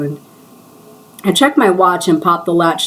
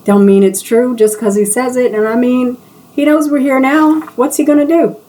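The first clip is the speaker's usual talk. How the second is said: rising indignation; 6 semitones higher than usual. Acted. Coworker worked up about the rival shop, rising exasperation. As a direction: build to an exasperated peak.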